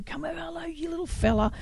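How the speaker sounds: put-on high, whispery welcome to a little kid; soft friendly greeting. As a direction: coax a small child over, gently. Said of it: "he was really happy".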